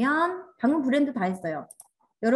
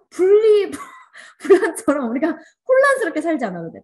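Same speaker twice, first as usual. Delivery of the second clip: laughing outburst; 7 semitones higher, 7 dB louder than usual. Real, not acted. Excited laughing outburst to class.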